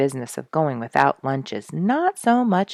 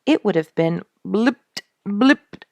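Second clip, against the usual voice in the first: higher, louder mock blips; imitating gurgle sounds; 5 semitones higher, 5 dB louder than usual. Narrator imitating Duke's gurgling, mock blips. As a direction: spell out the gurgling noises. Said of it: "If I were to spell the sounds".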